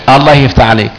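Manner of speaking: normal talking voice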